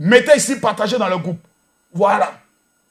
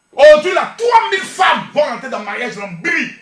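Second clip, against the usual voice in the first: preaching shout; 4 dB louder than usual. Real, not acted. Prêcheur qui proclame.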